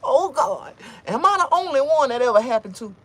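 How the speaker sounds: high, loud pleading wail; anguished cry. Retold or imitated. Crying out to God at the altar. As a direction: wail it to the heavens in despair. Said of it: "and I'm like".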